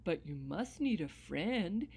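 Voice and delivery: dog telling the grumpy leprechaun everyone needs friends; gentle dog voice. Acted. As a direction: coax him kindly like a hopeful pup. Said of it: "the dog said".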